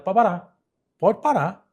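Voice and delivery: loud, sharp "stop right there"; angry interruption. Retold or imitated. Sister cutting off her twin at the consultation, loud and angry. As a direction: cut her off loudly, fed up.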